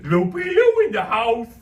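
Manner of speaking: loud, high cartoon holler; boisterous entrance. Loopy Lou bounds down the hallway announcing himself, boisterous holler. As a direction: announce yourself like a zany clown. Imaginary friend bursts in hollering.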